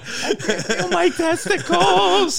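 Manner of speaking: high, slow, laughing mock scream. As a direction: stretch it out in a laughing high wail.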